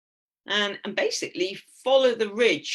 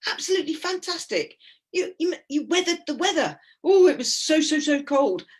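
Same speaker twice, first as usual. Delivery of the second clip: much higher, hammed-up cheery guide praising the ladies; Swiss guide voice; 10 semitones higher, 4 dB louder than usual. Swiss guide congratulating the returning climbers, high hammed-up praise. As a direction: gush like a delighted mountain guide, pitched way up. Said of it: "the Swiss guide was there, he's like".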